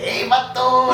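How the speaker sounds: quick street slang voice. Shown as a demonstration.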